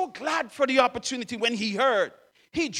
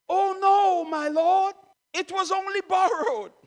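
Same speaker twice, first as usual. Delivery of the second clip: anxious cry; 7 semitones higher than usual. Acted. Young man to the prophet over the borrowed axe, anxious high cry. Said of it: "the anxiety I hear in this young man's voice".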